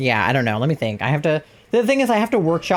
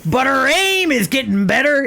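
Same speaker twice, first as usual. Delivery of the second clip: Grunkle Stan; 9 semitones higher than usual. Shown as a demonstration. Repeating the punchline for friends as a gruff old man. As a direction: repeat the punchline as the cranky uncle. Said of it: "vaguely Grunkle Stan-esque".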